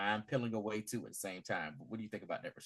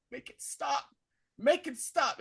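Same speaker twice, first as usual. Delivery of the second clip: mock desperate plea, pitched way up; acted-out frantic begging; high-pitched pleading; 13 semitones higher than usual. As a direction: beg in a high, retold panic. Acting out inmate begging.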